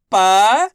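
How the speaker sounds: पा loud, held long and rising; single-syllable drill. Shown as a demonstration. Teacher sounding each letter separately, पा held and rising. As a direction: sound out पा alone, swinging it upward. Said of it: "एक एक अक्षर का उच्चारण".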